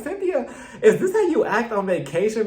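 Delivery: mock-outraged scolding; exasperated callout. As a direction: call her out in disbelief. Speaker confronts Cynthia in retold scene.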